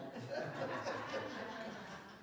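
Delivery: short wordless laugh. Retold or imitated. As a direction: laugh it off, lost for an answer. Laughs after the server's retort.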